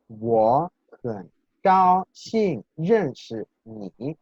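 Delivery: "Wo hen gao xin ren shi ni" slowly, word by word with exaggerated tones; tone demonstration. Shown as a demonstration. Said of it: "los tonos". ("Wo hen gao xin ren shi ni" lento, marcando cada tono)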